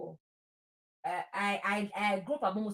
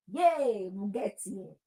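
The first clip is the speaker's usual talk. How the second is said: ordinary talk, nothing marked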